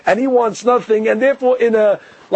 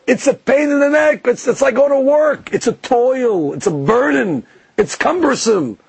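higher, whiny complaint about the chores of the Seder; scornful son; 6 semitones higher than usual. Retold or imitated. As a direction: whine it like a put-upon kid who thinks it's a burden. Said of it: "So you see already his attitude".

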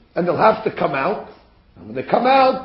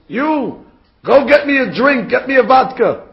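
raised, higher voice ordering a servant about; bossy shout at an underling; barked order; 6 semitones higher, 5 dB louder than usual. Retold or imitated. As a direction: snap out a loud, imperious command. General orders Napoleon around.

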